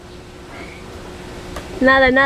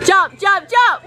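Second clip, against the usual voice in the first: urgent excited cheering; cheering shout; 6 semitones higher, 3 dB louder, slower than usual. Real, not acted. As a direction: yell it, building up. Cheering daughter to jump.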